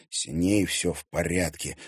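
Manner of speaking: usual speaking voice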